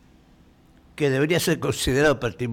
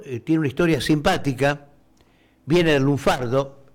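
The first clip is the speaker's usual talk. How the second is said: usual speaking voice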